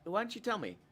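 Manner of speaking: ordinary talk, nothing marked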